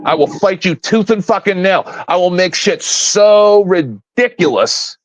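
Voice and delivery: usual speaking voice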